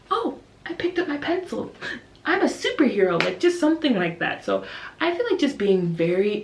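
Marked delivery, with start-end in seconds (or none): 0.1-3.2 put-on gasping, higher-pitched child marvelling at themselves; amazed little kid. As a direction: imitate a small pupil full of wonder. Amazed small pupil acted out.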